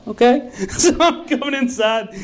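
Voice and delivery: amused, half laughing; laughing while retelling. Real, not acted. Laughing at memory.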